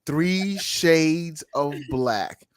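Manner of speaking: slow emphatic repeat. Real, not acted. Repeats phrase slowly to drive it home.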